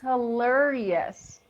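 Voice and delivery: slow, careful "Hilarious" with the weight on "lar"; stress on second syllable. Shown as a demonstration. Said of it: "this is is on the second syllable".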